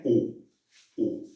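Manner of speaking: U, U said as Spanish u, fading; Spanish u sound. Shown as a demonstration. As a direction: show U, U like a plain Spanish u. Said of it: "se pronuncia como nuestro U". (la u castellana, U, U)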